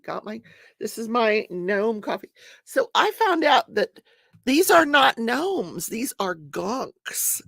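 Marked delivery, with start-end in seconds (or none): 4.4-7.4 drawn-out reveal; slow down for the punchline; slow emphatic. Real, not acted.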